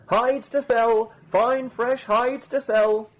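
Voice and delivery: high, drawn-out street-seller call; hawker's cry. A narrator's reading. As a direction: call your wares slowly across the fair. Hawking hides at a fair.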